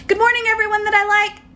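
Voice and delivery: high, pointed sing-song greeting; wide pitch range. Acted.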